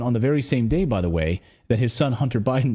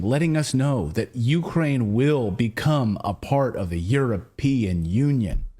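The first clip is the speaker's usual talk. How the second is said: slow, deliberate imitation of an emphatic minister; lightly mimicked emphatic statement; mock emphatic pausing; slower than usual. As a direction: underline every word like the minister did. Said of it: "you underlining it, pausing".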